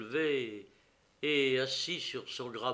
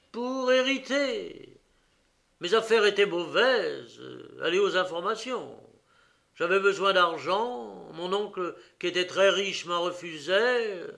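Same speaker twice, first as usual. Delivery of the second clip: defiant confession; 6 semitones higher, 8 dB louder than usual. Acted. Prisoner explains the murder motive, loud defiant voice. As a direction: throw the motive back at him.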